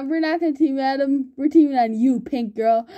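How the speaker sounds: ordinary talk, nothing marked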